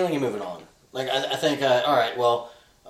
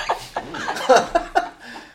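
breathy high laugh; 14 semitones higher than usual. Real, not acted. Laughing after the drunk remark.

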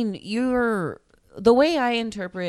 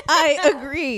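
high, louder put-on voice; Opie's voice; 7 semitones higher, 5 dB louder, slower than usual. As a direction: chirp it up high like a little character. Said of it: "Opie said".

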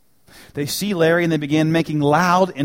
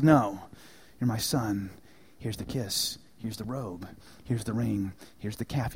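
tender father; 5 semitones lower, 8 dB quieter than usual. Retold or imitated. Father welcomes prodigal son.

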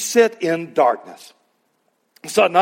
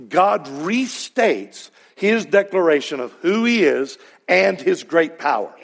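ordinary talk

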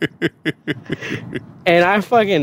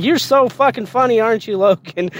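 mocking drill sergeant; 6 semitones higher than usual.

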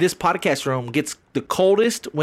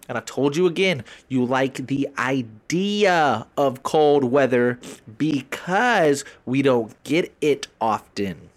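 ordinary delivery

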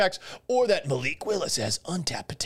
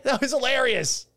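laughing through the words, pitched high; giggling, high and amused; 9 semitones higher than usual. Real, not acted. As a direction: break into a laugh as you say it. Laughing at the pick again.

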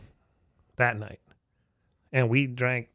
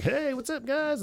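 high carefree hello; mock breezy greeting; 13 semitones higher, slower than usual. Friends walking in, imitated with a high breezy hello. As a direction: stroll in all casual.

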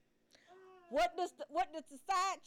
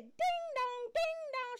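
doorbell chime; 9 semitones higher, slower than usual. Retold or imitated. Doorbell imitation again.

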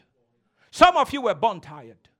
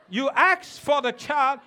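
ordinary talk

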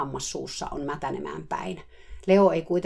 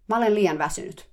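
short, higher, louder brush-off; curtly; 5 semitones higher, 5 dB louder than usual. Retold or imitated. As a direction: snap back a curt refusal. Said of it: "vastasi napakasti". (napakasti vastaten)

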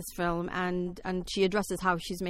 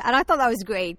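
a louder, amused repeat after a laugh; louder, still laughing; 4 semitones higher, 7 dB louder than usual. Real, not acted. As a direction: restart it louder with a laugh left in the voice.

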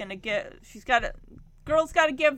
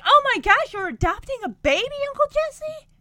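high, loud gushing surprise; retold delighted outburst, pitched way up; imitated excited niece; 9 semitones higher, 6 dB louder than usual. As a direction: squeal it as thrilled mock astonishment. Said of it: "Stephanie, like, picks that up".

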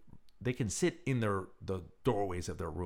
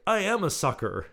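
jokey exclamation; 11 semitones higher, 9 dB louder than usual. Retold or imitated. Joking reply to nurse.

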